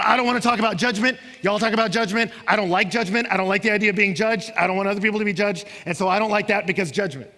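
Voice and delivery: loud, fast whiny protest; preacher mocking listeners who balk at judgment, loud and quick. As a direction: voice people's complaints against judgment. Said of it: "They go".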